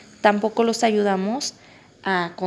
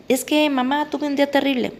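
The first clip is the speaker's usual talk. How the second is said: put-on higher, louder whiny kid voice; complaining child; 5 semitones higher, 3 dB louder than usual. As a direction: play the kid with a terrible day.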